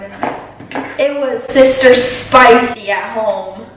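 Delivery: loud, slow and sassy; emphatic sass. Real, not acted. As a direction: lean hard into sister spicy. Sassy remark about home drama.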